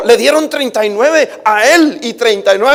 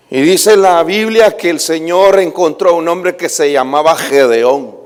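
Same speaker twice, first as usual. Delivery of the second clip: ordinary talk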